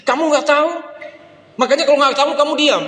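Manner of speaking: put-on high, fast, furious child; child shouting down friends who pray; angry child. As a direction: snap them into silence. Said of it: "saya kok jadi marah dia".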